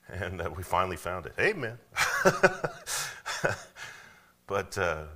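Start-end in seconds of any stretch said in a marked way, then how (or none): 1.8-4.0 laugh. Real, not acted.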